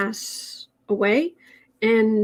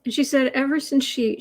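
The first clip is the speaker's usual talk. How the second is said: ordinary delivery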